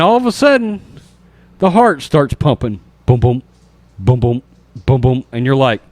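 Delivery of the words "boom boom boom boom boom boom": low, steady heartbeat booms; imitated heartbeat. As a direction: thump it out like a beating heart. Heart suddenly starts pumping.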